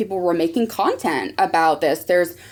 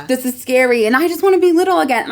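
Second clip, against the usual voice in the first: higher, rushed mild panic; dramatic, pitched-up inner cry; mock panic; 5 semitones higher, 4 dB louder than usual. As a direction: play up a kid's dread of growing up. Acting out her preteen self's panic.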